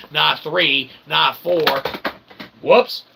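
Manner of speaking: ordinary delivery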